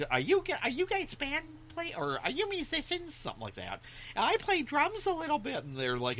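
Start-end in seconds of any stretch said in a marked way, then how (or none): 0.1-3.1 put-on very high child voice; little kid. Retells himself as seven-year-old asking musicians. 4.2-5.6 put-on very high child voice; little kid. Retells himself as seven-year-old.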